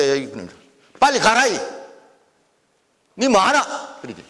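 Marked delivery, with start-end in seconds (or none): 1.0-4.0 higher-pitched Gyeongsang urging; Gyeongsang dialect. Shown as a demonstration. Lecturer quoting locals telling someone to go, Gyeongsang dialect. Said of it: "경상도 토속자리".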